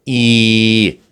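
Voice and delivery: ई said alone as a long, bright 'ee'; isolated vowel demo. Shown as a demonstration. Teacher isolating the vowel ई for learners. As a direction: hold ई as a clean long 'ee'. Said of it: "उसकी तरह sound है".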